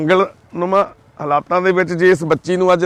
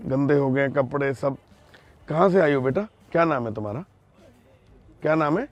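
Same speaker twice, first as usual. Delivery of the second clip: soft soothing; 3 semitones lower, 8 dB quieter than usual. Real, not acted. Soothing a frightened crying girl.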